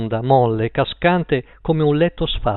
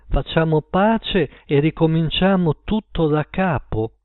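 ordinary delivery

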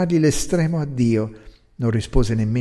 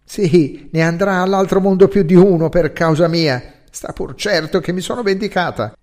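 louder, fired-up vengeful pride; growing animated; 4 semitones higher, 7 dB louder than usual. A narrator's reading. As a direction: she comes alive as she boasts of revenge. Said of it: "E lei, animandosi".

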